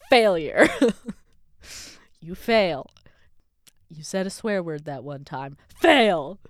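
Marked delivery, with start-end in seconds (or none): 0.1-2.9 loud taunt on Failure, rising; hammed-up inner critic yelling Failure; mock scolding shout. Inner voice mocking her. 5.7-6.4 loud, sharp Fail!; hammed-up inner critic; mock scolding shout. Inner voice mocking her.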